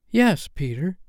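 put-on high, lightly pleading girl voice; a gentle plea voiced as a girl; pleading Wendy. A narrator's reading.

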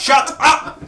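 high laughing shout up. Real, not acted. Laughing at the guest's interruption.

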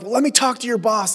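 acted customer outrage; indignant demand. Acted demand to insurance company.

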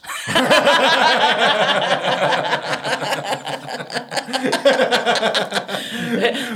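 long high laugh of relief; a real, long, rising laugh. Real, not acted. Relief at wine offer.

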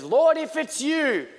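high, loud drawn-out call. Acted. Peter calling to Jesus on the water, calling out. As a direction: cry it out slowly across a distance.

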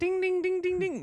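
high sing-song ding; game show bell. Pretend.

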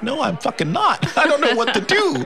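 high, hammed-up panicked protest; mock panic. Telling how he answered mom's boast, in mock panic. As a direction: squeal it in comic desperation.

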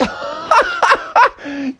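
high cackling laugh; mocking laugh. Froggy laughing at the kitten team, high cackle. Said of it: "he started laughing and laughing".

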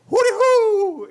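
high, loud drawn-out hoot; hooting holler. Shown as a demonstration. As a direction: holler it as a signal call. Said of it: "hooting and hollering".

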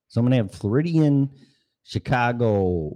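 normal talking voice

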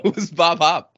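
"Hope" said as "hop", loud; mock mispronunciation. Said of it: "I accidentally deleted the E".

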